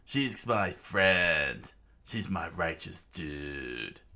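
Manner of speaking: very slow, heartfelt; slow earnest vow. Acted. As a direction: lay it down word by word.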